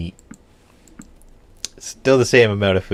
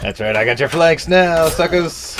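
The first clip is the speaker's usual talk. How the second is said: gloating taunt; 9 semitones higher, 4 dB louder than usual. Real, not acted. Gloating as he flanks enemies.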